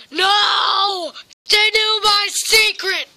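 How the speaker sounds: loud, high panicked yell; panicked shout. Acted. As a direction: cry out in alarm. Puppet panics over secret.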